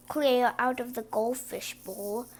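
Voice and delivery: ordinary delivery, nothing marked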